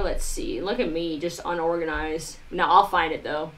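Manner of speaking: ordinary talk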